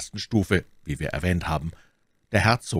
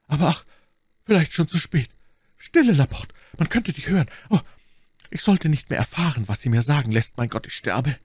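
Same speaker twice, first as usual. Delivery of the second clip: high, urgent dying man's voice, sinking at the end; anguished deathbed plea; 8 semitones higher than usual. A narrator's reading. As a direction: plead with failing strength, high then fading. Dying duke and servant talk. (verzweifelt, wie ein Sterbender)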